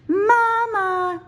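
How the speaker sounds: high, loud call. A narrator's reading. Cub calling for Mama from the trees, high and loud. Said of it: "she called".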